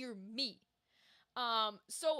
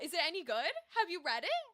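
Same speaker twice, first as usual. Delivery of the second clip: ordinary talk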